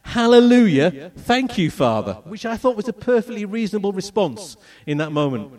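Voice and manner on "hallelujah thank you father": loud, joyful hallelujah cry, stretched out slow; retold shout. Waking from surgery and thanking God, a loud joyful shout. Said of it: "and I shout".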